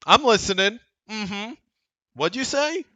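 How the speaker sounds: high mock wife voice, pointed and teasing; imitating his wife. Husband mimicking his wife overhearing him, high mock voice. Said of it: "she'd be like".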